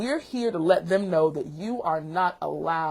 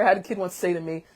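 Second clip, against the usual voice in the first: normal talking voice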